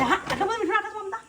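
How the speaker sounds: high, quick put-on voice; rushed high mock questioning; imitated viewer. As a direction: mimic a viewer's startled question. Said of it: "કહેશે".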